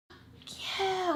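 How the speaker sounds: high, sharp retold yell; really loud yell. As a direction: shout it at him. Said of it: "like, really loud".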